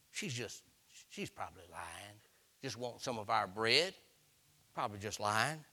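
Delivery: low, soft scornful grumble; dismissive muttering. Retold or imitated. Church folk to the Lord, muttering that the woman is lying. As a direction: brush her off under your breath.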